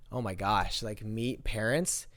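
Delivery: low, soft, slow dismay; subdued dread. Retold or imitated. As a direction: dread under the breath, retold. Retells dread at meeting parents.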